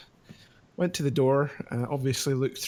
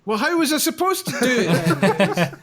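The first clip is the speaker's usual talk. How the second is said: high, loud, indignant retort; re-enacted exasperation, raised voice; exasperated protest; 9 semitones higher, 7 dB louder than usual. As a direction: argue back at dad in frustration. Retold quarrel with dad over golf.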